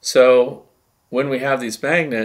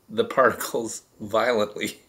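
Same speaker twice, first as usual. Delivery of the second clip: real laugh breaking into the words; 3 dB quieter than usual. Real, not acted. Explaining how the field vibrates particles, laughing. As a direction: try to explain through a laugh. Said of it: "I'm laughing".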